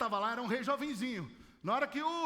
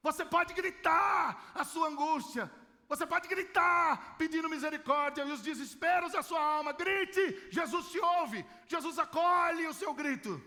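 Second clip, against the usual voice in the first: higher, louder call to cry out; fervent preaching shout; 4 semitones higher, 4 dB louder, slower than usual. Real, not acted. Preacher urging the congregation to cry out, shouting fervently. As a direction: urge the whole church to shout their anguish.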